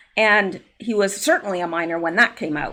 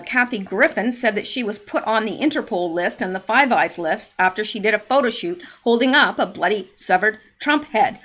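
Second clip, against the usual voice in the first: usual speaking voice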